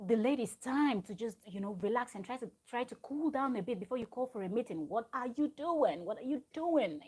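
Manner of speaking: "What are you doing?" said in disbelief; exasperated scolding. Retold or imitated. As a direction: tell her off, fed up and incredulous. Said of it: "I was like".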